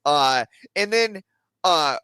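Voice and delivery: ordinary delivery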